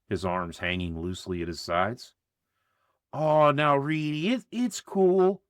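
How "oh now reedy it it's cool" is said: high, slow nervous stammer. A narrator's reading. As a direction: back down, stumbling over words. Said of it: "Mitch stammered".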